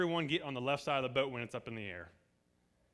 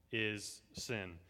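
normal talking voice

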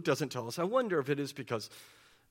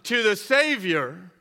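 retold crowd shout; 5 semitones higher, 6 dB louder than usual. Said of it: "who shouted".

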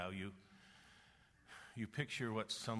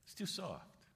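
much higher, thin mocking voice; mock wimpy voice; 12 semitones higher, slower than usual. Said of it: "This is way too wimpy".

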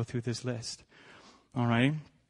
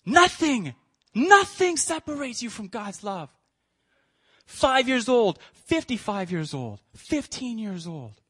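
impassioned preaching; 9 semitones higher, slower than usual. Acted. Preacher's closing refrain.

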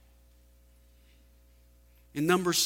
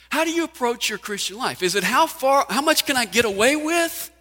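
loud, high, pressing preacher's challenge; preaching at full force; 9 semitones higher, 8 dB louder than usual. Acted. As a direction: drive the question home to the congregation. Preacher raises the stakes.

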